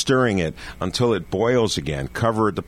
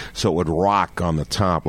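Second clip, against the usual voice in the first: normal talking voice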